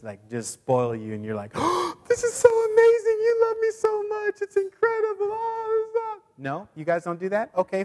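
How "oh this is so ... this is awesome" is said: very high squealing gush of delight; gushing grateful receiver. Pretend. Mocking a thrilled gift receiver, squealing high. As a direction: squeal like someone overjoyed with a gift. Mock spoiled recipient gushing.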